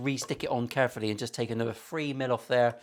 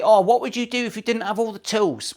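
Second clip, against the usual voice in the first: mock commenter; 6 semitones higher than usual. Mocking imagined commenter.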